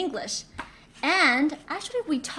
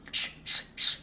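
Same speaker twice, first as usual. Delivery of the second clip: quick repeated voiceless shushes; shushing. Retold or imitated. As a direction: shush a barking dog in short bursts. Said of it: "I'll shush him".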